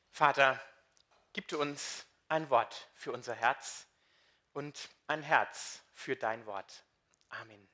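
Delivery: hushed, unhurried prayer to God. Acted. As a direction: pray it softly, inward. (leises, langsames Gebet)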